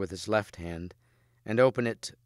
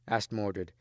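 ordinary delivery

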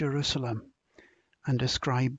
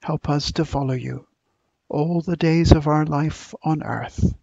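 public prayer; 5 dB louder than usual. Acted. Minister leading the congregation in prayer, earnest and raised. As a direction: lift each petition up to God for the gathered church.

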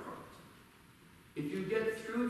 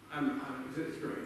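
normal talking voice

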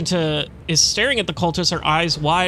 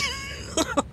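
laughter trailing off; 8 dB quieter than usual. Real, not acted. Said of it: "I was so concerned".

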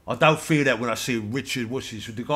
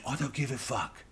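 hushed mock Queen; 8 dB quieter than usual.

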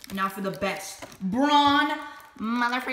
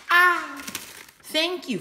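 ordinary talk

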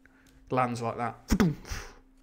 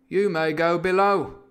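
loud, slow, raised-pitch order; captain's dismissal; 5 semitones higher, 8 dB louder, slower than usual. Acted. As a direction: dismiss him slowly and loudly as captain. Captain dismissing Long John.

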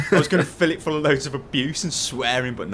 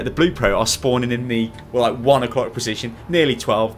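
ordinary talk, nothing marked

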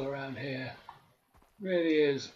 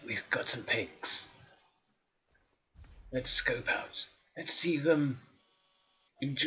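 nature documentary. Acted. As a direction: whisper it like a wildlife documentary. Hushed nature documentary narration.

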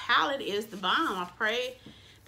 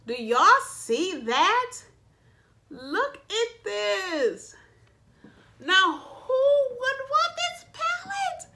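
much higher, slow, squealing delight; thrilled gushing; 11 semitones higher, 5 dB louder, much slower than usual. Real, not acted. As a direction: drag it out, squeal over the palette. Showing off the palette, thrilled.

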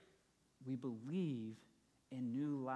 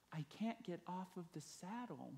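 high, soft, tearful plea; a hint of crying, pitched up and quiet; in tears; 6 semitones higher, 4 dB quieter than usual. The stuck wife pleads with her husband, near tears. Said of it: "in tears saying".

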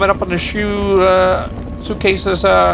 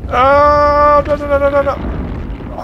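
rising panic no no; frantic dread, caught again; panicked; 7 semitones higher, slower than usual. Real, not acted. Monster closing in.

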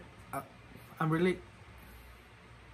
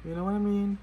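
silly put-on voice; 5 semitones higher, 6 dB louder than usual. Playful silly voice.

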